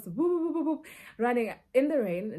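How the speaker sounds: high loud whoop whoop; running sound effect. Retold or imitated. Retelling the chase, calling out whoop whoop. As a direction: vocalize the dash across the road. Running across the road after thief.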